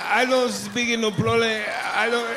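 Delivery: squeezed, strained broken English; strained foreign accent. Retold or imitated. Clerk who can't speak English, mock strained accent. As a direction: sound like you're mid-push on the toilet. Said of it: "a sound coming out of their face".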